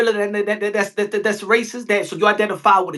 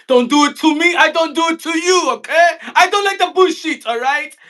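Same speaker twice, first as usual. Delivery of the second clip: a hammed-up, higher, louder rant; 1 mock shouting rant; 4 semitones higher, 4 dB louder than usual. Mocking Punch, a hammed-up, higher, louder rant. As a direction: yell it like a fake-offended fighter.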